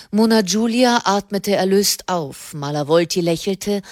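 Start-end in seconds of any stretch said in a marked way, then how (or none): none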